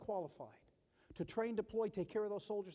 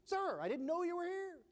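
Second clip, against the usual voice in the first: higher, caught-off-guard mock surprise; startled sergeant; 11 semitones higher than usual. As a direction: play the sergeant suddenly spotting the boss. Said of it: "and he goes".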